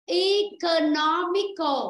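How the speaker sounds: Economical slowly, syllable by syllable, weight on "no"; stress on third syllable. Shown as a demonstration. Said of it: "Phải rơi vào âm thứ ba". (Economical đọc chậm, nhấn âm ba "no")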